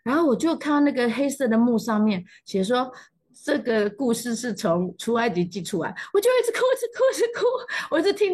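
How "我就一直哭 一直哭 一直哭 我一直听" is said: high pitched, giggling through the words; laughing while telling. Real, not acted. Telling a friend how she cried at a cartoon, laughing. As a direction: laugh at herself as she tells it.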